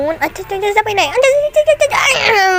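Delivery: high, laughing half-crying squeals; frantic squeal. Real, not acted. Player chasing a survivor and missing hits, squealing high with laughter. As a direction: squeal in panicked glee. Frantic chase, hitting misses.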